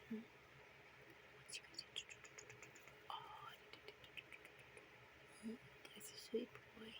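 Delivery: hushed whisper so the bird can sleep; tender hushed whisper at bedtime; soft whisper to a bird. Real, not acted. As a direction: whisper it gently to a sleepy pet.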